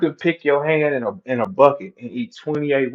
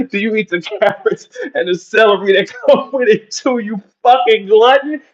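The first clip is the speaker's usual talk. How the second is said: higher, louder, laughing through the words; laughing while mocking; 6 semitones higher, 4 dB louder than usual. Real, not acted. Ribbing T-Top over the wings, laughing as he mocks. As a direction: tease him through the giggles.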